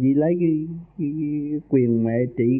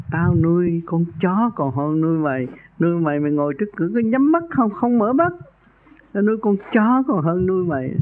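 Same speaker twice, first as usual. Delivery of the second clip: imitating a scolding wife, pitched higher; mock tirade in a raised, higher voice; vợ mắng chửi; 5 semitones higher than usual. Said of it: "bà Tám chửi tao còn mạnh hơn". (giả giọng vợ chửi chồng)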